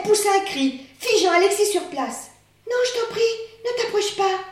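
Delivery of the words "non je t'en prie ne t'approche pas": voix de tortue beaucoup plus haute, suppliante; supplier, haut perché et effrayé; tortue effrayée. Acted. (une tortue apeurée supplie, voix très aiguë)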